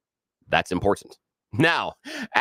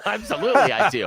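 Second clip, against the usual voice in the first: pitched way up through a laugh; laughing high; 11 semitones higher than usual. Real, not acted. Guest laughing about her own big mouth, pitched way up. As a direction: laugh the word out, squeaky and amused.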